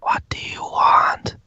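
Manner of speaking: slow, sinister cauldron whisper; hushed, eerie voice with no tone. A narrator's reading. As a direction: whisper it slowly, drawing out each word. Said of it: "it kind of just whispers to you".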